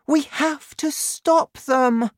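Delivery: put-on high, slow, urgent girl's voice; urgent cry. A narrator's reading. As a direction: plead high and urgently as the girl. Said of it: "she cried".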